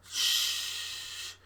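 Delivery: long, voiceless shush; hushing shh. Acted. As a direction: hush them with a drawn-out breath. Hushing the suitor.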